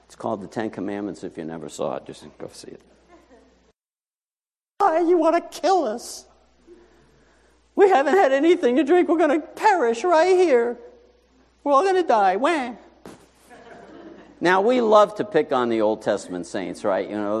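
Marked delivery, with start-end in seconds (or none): 4.8-12.7 high, whining complaint; mock the grumbling crowd; whiny Israelites.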